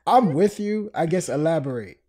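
usual speaking voice

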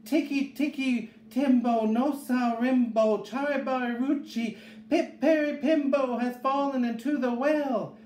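put-on high, insistent child's cry; high-pitched desperate little boy; panted alarm. A narrator's reading. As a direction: push the long name out high and urgent, as if winded. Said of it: "he panted".

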